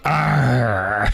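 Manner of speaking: gruff pirate "arr"; pirate growl. Pretend. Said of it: "I'm gonna be sitting here with a pirate".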